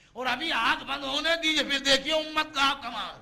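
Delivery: loud, high declamation; impassioned preaching. Real, not acted. Preacher on what the community will do afterwards, loud declamation. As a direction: hurl it at the congregation.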